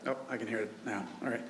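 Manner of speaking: ordinary delivery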